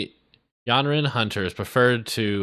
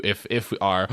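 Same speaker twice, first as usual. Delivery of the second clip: ordinary talk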